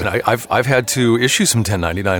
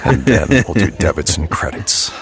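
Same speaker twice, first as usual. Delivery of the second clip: low, slow seductive tease; sultry perfume ad; 5 semitones lower than usual. Pretend. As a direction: play it like a steamy ad. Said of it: "Okay, I'll stop".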